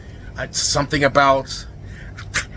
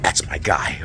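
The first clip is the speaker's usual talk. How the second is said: normal talking voice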